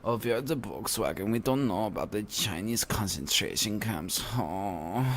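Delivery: soft, hammed-up German accent; quiet comic German accent; mock German accent. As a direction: play a feebly denying German company man. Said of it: "Abby likes your accent".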